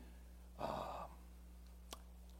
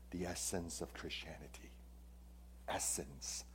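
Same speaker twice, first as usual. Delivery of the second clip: a reverent whisper, much softer; hushed whisper; 6 semitones lower, 17 dB quieter than usual. Real, not acted. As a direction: drop to a whisper for the key point. Preacher hushes for a key point.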